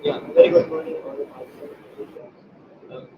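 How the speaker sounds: very soft, faint formal welcome; barely audible. Real, not acted. Organizer opening the webinar for attendees, barely audible. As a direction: speak far too softly to be heard. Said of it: "could you speak up a little bit higher".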